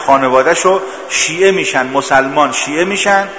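ordinary talk, nothing marked

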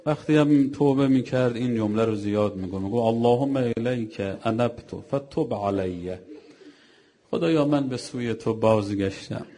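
mournful preacher calling out repentance; impassioned preaching. Acted. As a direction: pour out the sinner's confession to the mourners. Preacher's mourning recital builds.

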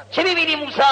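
high, ringing question; insistent divine question. Acted. God questioning Moses after the rock splits, insistent divine question. God asks again, more forcefully.

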